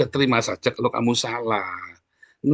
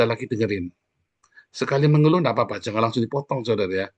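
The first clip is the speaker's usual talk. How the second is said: usual speaking voice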